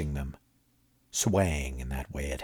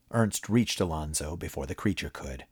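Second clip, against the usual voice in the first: ordinary delivery, nothing marked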